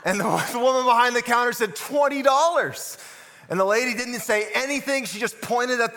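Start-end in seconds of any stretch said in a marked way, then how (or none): 1.7-3.0 high, slow called-out announcement; called-out price. Retold or imitated. Cashier calls out the amount, loud and slow. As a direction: cashier announcing the total loudly across the counter.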